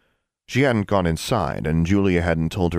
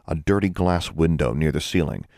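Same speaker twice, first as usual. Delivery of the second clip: ordinary delivery, nothing marked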